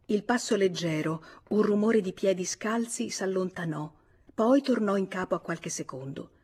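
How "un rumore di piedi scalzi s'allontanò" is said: usual speaking voice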